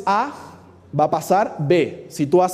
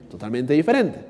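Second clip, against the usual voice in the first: ordinary delivery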